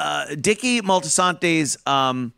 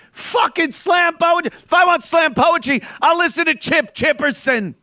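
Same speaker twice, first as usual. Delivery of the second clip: much higher, louder exasperated rant; angry shout; 10 semitones higher, 3 dB louder than usual. Real, not acted. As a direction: yell it out in disgust. Ranting about slam poetry.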